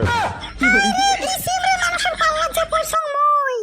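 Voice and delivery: high-pitched scolding wife voice; a wife nagging her husband to go shopping. Acted. Wife scolds husband about the in-law's visit, high and nagging.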